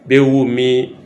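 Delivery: ordinary talk, nothing marked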